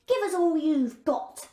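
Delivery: a land pirate demanding loot; a hint of a rough pirate girl. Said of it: "said the girl".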